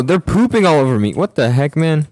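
ordinary talk, nothing marked

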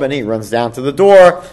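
usual speaking voice

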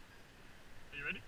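slow soft whispered question; whispering. Real, not acted. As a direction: breathe the question quietly. Asking before jump, hushed.

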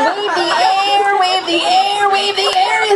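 high, slow chant of the command; excited urging. Acted. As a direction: whip the crowd up. Hypnotist urging the crowd.

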